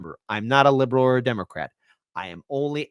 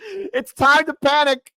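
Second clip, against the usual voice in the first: mock panic; 11 semitones higher, 4 dB louder, slower than usual.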